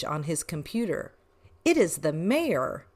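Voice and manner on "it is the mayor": plain reading voice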